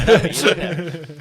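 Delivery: leaning on "look" through a laugh; laughing retold punchline. Recalling the speaking coach's quip, laughing loudly. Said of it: "He's like".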